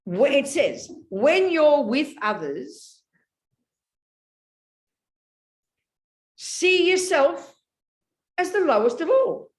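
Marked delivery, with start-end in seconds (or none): 1.1-9.5 slow, higher and louder, every phrase weighted; "see yourself as the lowest of all" laid down word by word with long pauses; slow emphatic quote. Shown as a demonstration. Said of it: "Hear what I'm about to say".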